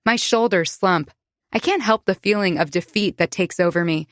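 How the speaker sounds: usual speaking voice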